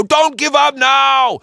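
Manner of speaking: high, loud shouted plea; urgent plea. A narrator's reading. Joe shouting at his crew to keep fighting.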